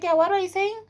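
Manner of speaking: high-pitched imitation of a rude retort; mock rude phone voice. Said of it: "in a very rude way".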